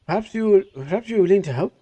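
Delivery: a hint of an Israeli accent, offering help; attempted Israeli accent. Jafar asks the strangers for help, light Israeli accent try. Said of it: "I don't know how to do an Israeli accent".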